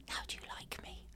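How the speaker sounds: hushed, unvoiced plea; a whispered plea for approval. Shown as a demonstration. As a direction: breathe it out as a needy whisper. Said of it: "And then".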